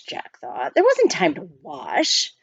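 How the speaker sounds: usual speaking voice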